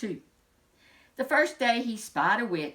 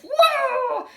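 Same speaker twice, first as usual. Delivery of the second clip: delighted whoop; 10 dB louder than usual. Acted.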